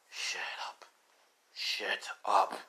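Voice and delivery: hushed, unvoiced warning; low whispered fury, acted; seething. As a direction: whisper it with held-in anger. Seething reply to the taunts.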